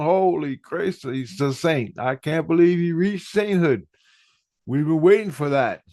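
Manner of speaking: high, excited voice of disbelief; amazed exclamation. Retold or imitated. Christ reacts to his reaching sainthood, excited amazement. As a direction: imitate someone amazed by long-awaited news.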